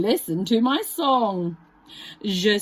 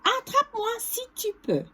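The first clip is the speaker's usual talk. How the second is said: high singsong taunt of the runaway galette; bright childlike galette taunt; 11 semitones higher, 4 dB quieter, faster than usual. Acted. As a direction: tease them from far ahead.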